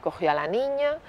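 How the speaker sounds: normal talking voice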